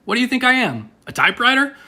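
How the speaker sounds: high-pitched incredulous retort; punchline turtle, pitched well up; indignant joke turtle. Acted. Second turtle answers the soap request, high mock-indignant voice. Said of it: "Second turtle says".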